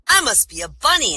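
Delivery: excited rabbit. Acted. Rabbit finding out what it is, excited high voice. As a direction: announce it as an elated rabbit.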